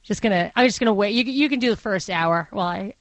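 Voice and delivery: normal talking voice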